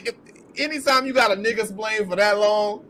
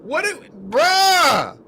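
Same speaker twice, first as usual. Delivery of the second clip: incredulous bruh; 7 semitones higher, much slower than usual. Real, not acted. Reacting to her outfit.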